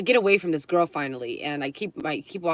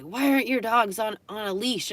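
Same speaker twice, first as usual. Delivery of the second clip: higher mock-indignant demand; scolding; 5 semitones higher than usual. Dog owner scolding over leashes, mildly imitated indignation. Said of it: "she's screaming at me".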